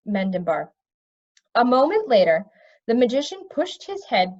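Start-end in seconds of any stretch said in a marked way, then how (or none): none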